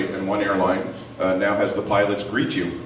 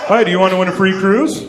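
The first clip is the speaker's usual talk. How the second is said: telemarketer pitch; 9 semitones higher, 6 dB louder than usual. Pretend.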